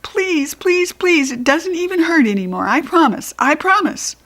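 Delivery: high, desperate begging; child begging Mother to spare the swim ring; pleading. Acted. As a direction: beg her not to cut it. Pleading to save parrot.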